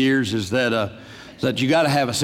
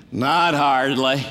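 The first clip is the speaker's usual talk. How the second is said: 1 Duke impression; much slower than usual. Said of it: "Remember what the Duke said?".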